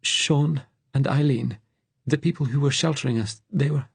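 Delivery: high, halting grief; high, tremulous fugitive voice; trembling voice. A narrator's reading. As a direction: falter as you name the dead. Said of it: "his voice trembled".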